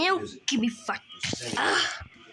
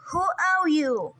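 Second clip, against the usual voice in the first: strange voice. Pretend. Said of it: "um, in a strange voice".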